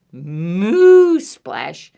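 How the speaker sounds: long moos, each ending in a splash; big stretched cow moos; cow mooing. Acted. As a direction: draw out the moo like a cow, then splash. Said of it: "Cow says".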